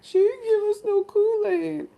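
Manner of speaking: high, loud whiny mock wail; mock crying. Cousins cry over not getting Kool-Aid, mock wailing. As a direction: imitate a tearful complaint over a drink. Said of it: "They started crying. They were like".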